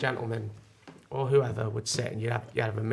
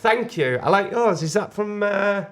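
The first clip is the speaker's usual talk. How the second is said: put-on posh Chelsea accent; 8 semitones higher, 6 dB louder than usual. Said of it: "nice Chelsea accent you had there".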